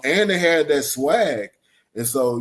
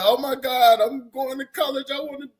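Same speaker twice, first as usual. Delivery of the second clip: high-pitched mock excitement; mock starry-eyed student in a high voice; gushing student. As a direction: squeal it like an overeager freshman, hammed up. Mocks an eager college-bound student.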